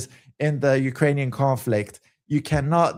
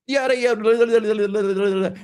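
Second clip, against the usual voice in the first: higher-pitched, run-together gibberish chatter; mock babble; 4 semitones higher, faster than usual. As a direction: babble like two voices talking over each other. Mimics two overlapping dubbed voices.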